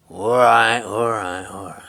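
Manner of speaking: loud then fading grumble; grumbling giant. A narrator's reading. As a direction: give in gruffly, trailing off.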